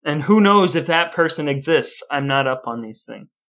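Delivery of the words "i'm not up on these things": normal talking voice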